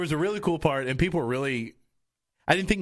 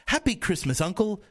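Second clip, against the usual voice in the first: put-on British accent; 7 semitones higher than usual. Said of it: "the best British accent I could possibly do".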